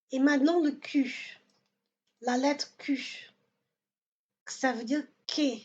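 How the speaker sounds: ordinary talk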